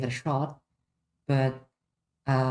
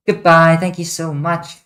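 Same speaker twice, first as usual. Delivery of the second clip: loud goodbye; 3 semitones higher, 10 dB louder than usual. Real, not acted. Sign-off.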